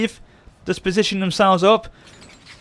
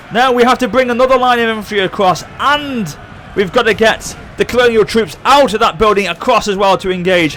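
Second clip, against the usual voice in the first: normal talking voice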